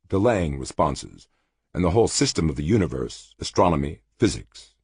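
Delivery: ordinary delivery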